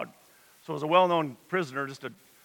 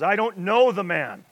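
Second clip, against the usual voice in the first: louder, higher sworn denial; cursing denial; 4 semitones higher, 7 dB louder than usual. Retold or imitated. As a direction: swear it at them, heated. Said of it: "Then he began to call down curses".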